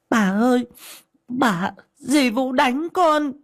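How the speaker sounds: high, slow wailing plea; crying child. Acted. Beaten girl running to grandma, crying. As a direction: sob it out to grandma. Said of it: "ôm mặt khóc thét lên".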